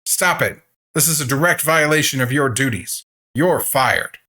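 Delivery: high, loud enraged rant; fuming doctor firing the caregiver; furious shouting. A narrator's reading. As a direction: fire him on the spot, yelling. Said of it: "the doctor shouts at him".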